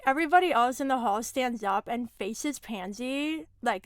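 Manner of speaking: ordinary delivery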